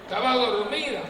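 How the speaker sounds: very loud playful shouting; mock tirade. Priest berating the dozing woman, loud mock anger. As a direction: hurl the insults in mock outrage.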